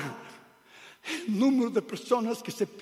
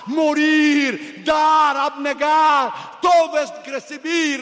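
fiery shouted sermon, building louder; preaching at full force; 5 semitones higher, 6 dB louder, slower than usual. Acted. Preacher denouncing the church to his congregation, shouting. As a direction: thunder it at the congregation.

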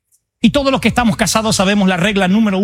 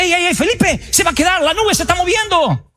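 high calling voice to a neighbour; 11 semitones higher than usual. Acted. Another neighbour calls Felipe to leave, high calling voice. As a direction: call out the news across the camp.

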